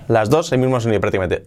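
usual speaking voice

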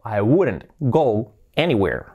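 slow, separated words; slow model sentence. Shown as a demonstration. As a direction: spell out the sentence slowly for learners. Teacher models sentence slowly, then naturally. (frase modelo, despacio y separada)

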